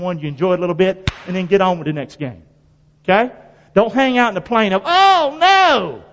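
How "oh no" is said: mock whine.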